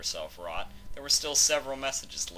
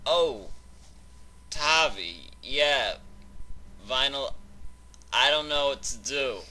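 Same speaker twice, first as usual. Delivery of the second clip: excited; 7 semitones higher, 5 dB louder than usual. Acted. Drunk friend on phone.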